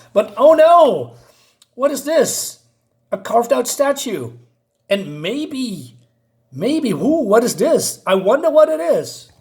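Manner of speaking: high, exaggerated fake surprise; mock suspense. Reviewer mocking the carved statue reveal, mock suspense. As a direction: ham up the wonder, swooping high with heavy sarcasm.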